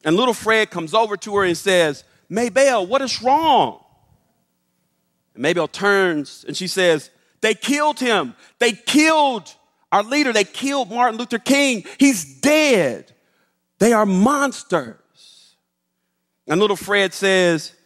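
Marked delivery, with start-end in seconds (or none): 2.3-3.8 put-on higher-pitched concerned child; acted boyish alarm; worried little boy. Voices worried eight-year-old Fred. 7.4-15.6 high, loud grief-stricken outcry; dramatized anguished wail of words; acted inconsolable anguish. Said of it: "she's sobbing inconsolably".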